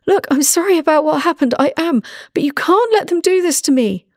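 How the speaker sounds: high, strained begging voice; pleading captive. Acted. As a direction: beg an old friend for help. Captive pleading for his life.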